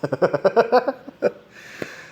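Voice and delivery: sudden high-pitched giggle; a quick laugh at the dog; high amused laugh. Real, not acted.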